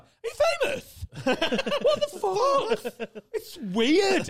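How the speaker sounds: high, loud mock disbelief, half laughing; hammed-up squeal of disbelief, dragged out; incredulous. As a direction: imitate a friend who can't believe it.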